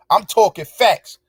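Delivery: ordinary talk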